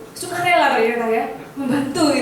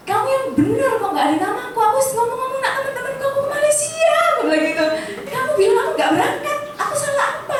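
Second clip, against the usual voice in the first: indignant whine pitched far higher; high-pitched protest; 10 semitones higher than usual. Retold or imitated. Speaker protesting to Roy that her name is missing, high indignant whine. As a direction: squeal the complaint back at Roy.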